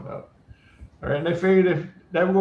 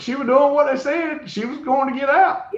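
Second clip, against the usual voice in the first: teasing; 10 semitones higher than usual. Real, not acted. Teasing defense of woman.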